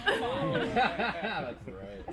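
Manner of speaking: laughing to himself. Real, not acted. Laughs at own joke.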